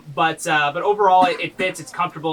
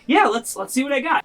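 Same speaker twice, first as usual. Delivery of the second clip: eager smith voice; 9 semitones higher than usual. Acted. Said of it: "he's like".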